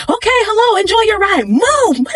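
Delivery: high, hammed-up worker shooing them off; pushy ride worker. Ride worker rushing the couple off, high mock voice.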